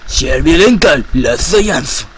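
loud made-up Japanese attack call; fake Japanese. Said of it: "en mi japonés inventado".

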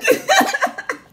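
shrill burst of laughter; high laugh. Real, not acted. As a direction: crack up high. Laughing at Sophia part.

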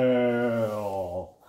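gasp, then one long-drawn booming word; intoned storybook climax; dramatic reveal. A narrator's reading. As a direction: announce the monster in a huge stretched tone. Big reveal of the whale.

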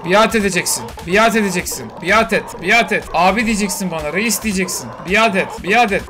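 bully it into obeying; domineering command. Pretend. Loud domineering demands at creature.

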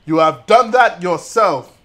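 hammed-up film rebuke with weighty words; stern retort. Streamer mimics scolding of Anakin, stern loud retort.

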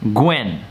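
'Gwen' in a short, sharp fall; exact fourth tone. Shown as a demonstration. Teacher modelling the word for repetition, exact falling tone. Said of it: "with that exact tone".

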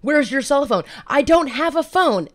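heated exchange. Retold or imitated. Heated classroom back-and-forth.

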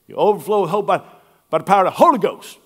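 Southern preaching flourish; Southern preacher. Retold or imitated. Pastor reading the verse as in the South. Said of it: "the emphasis on the first".

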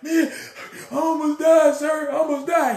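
high, whiny half-sob; mock crying. Recruit whimpering to the commander after nearly drowning. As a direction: play the scared recruit crying. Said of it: "I'm gonna be crying and stuff".

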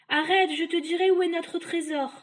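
high, loud desperate bargain; buy your release urgently; pleading witch. Acted. Trapped witch to the boy, high loud plea.

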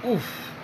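quiet strained oof from an aching back; pained oof. Real, not acted. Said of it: "Making old man noises".

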